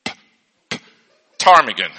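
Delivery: "P-, P-, ptarmigan" with a spat P and a loud start; sounding out the P. Shown as a demonstration. Teacher sounding out the silent P for the class. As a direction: pop the P twice, then say "ptarmigan". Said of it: "we don't really use the P".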